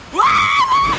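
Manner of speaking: high, loud "Wow!"; surprised shout. Real, not acted. Gamer yelling at a sudden shockwave, surprised shout. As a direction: blurt it out in real shock.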